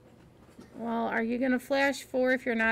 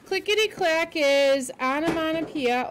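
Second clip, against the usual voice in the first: ordinary delivery, nothing marked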